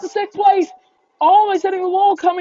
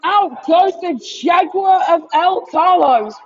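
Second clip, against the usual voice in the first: excited shouted crash call, higher and louder; motorsport commentator; 5 semitones higher, 4 dB louder than usual. Real, not acted. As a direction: call the crash at full volume, live.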